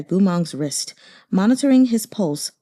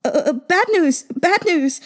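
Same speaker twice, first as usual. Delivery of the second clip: panicked shout; 10 semitones higher than usual. Acted. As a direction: burst in yelling the alarm. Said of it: "shouting at the top of his lungs".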